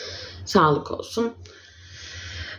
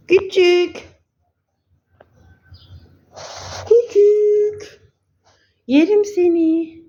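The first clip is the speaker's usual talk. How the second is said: much higher and louder, drawn-out cooing; 11 semitones higher, 4 dB louder, slower than usual. Real, not acted. Doting on her little bird, high squeaky baby talk. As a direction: squeeze the words out with adoration for a tiny pet.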